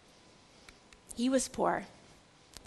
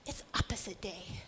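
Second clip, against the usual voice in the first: whispered aside; 7 semitones lower, 13 dB quieter than usual. Real, not acted. Hushed aside after beatitudes.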